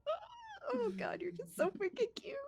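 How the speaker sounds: very high, slow cooing over a pet; Oriana cooing. Acted. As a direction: gush like it's the cutest thing. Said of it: "most exciting for Oriana".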